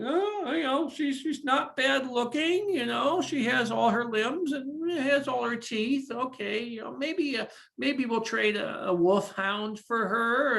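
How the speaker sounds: high-pitched scheming king voice; king haggling. Acted. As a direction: haggle like a shrewd old king. King haggling over bride price.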